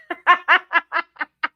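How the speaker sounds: big bright laugh breaking out; hearty laugh. Real, not acted. Laughing at a viewer who guessed her New Orleans accent.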